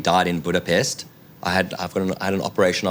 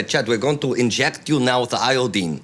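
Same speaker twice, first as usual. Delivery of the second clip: imitated doctor with a Budapest-turned-Russian accent; mock Russian-accented doctor; Russian-sounding accent; 3 semitones higher than usual. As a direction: put on an Eastern European accent. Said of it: "now I'm sounding Russian".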